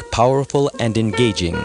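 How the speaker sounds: ordinary delivery, nothing marked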